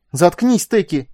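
loud, high snapped order; sharp despairing yell; desperate bark. A narrator's reading. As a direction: shut them up in desperation. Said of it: "в отчаянии рявкнула Хельда".

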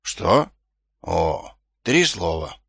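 low, tired murmur; weary. A narrator's reading. Vimes guesses at the librarian's charades, weary. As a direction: say it wearily, in short pieces. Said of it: "утомлённо произнёс он".

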